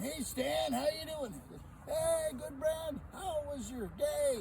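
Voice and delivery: high, loud neighbourly hello; hearty greeting. Acted. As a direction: call out a friendly hello. Neighbours greet each other.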